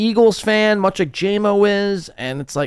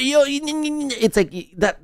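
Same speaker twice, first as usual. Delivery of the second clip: higher, slower mock northeastern Philly accent; 8 semitones higher, 3 dB quieter, slower than usual. Imitating the Philly guy's accent, slower and higher. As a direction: stretch it out in a Philly drawl. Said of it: "that northeastern Philly accent".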